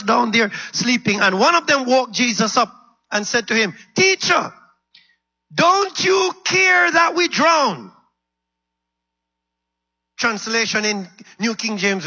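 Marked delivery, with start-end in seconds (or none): 3.9-7.9 high, slow, loud plea; desperate disciple crying for help; panicked shout. Acted. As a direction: cry out in panic, drawing out the words. Disciple waking Jesus in panic.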